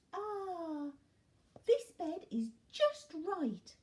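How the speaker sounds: high, slow sigh of comfort; contented Goldilocks. A narrator's reading. Goldilocks finds her bed, high and contented.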